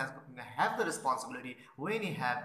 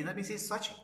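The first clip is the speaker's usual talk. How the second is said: ordinary talk, nothing marked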